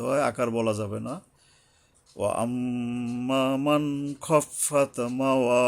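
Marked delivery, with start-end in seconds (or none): 2.2-5.6 slow, level tajweed chant; Quran recitation. Shown as a demonstration. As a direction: recite slowly with held nasals and long vowels. Recites the verse through. (তাজবিদসহ ধীরে কুরআন তিলাওয়াত)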